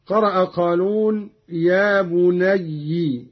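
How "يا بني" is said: بني said slowly as "bunayyi"; doubled y ending in i in بني; kasra on ya. Shown as a demonstration. Teacher showing how Qalun read it, slow kasra ending on بني. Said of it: "بكسر الياء".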